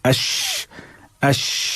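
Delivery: अश with the sh sound drawn out; sheen with hamza before it. Shown as a demonstration. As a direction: say अश, a hamza leading into a held श. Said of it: "अगर शीन के अदा होने में परेशानी". (हमज़ा लगाकर शीन का अभ्यास)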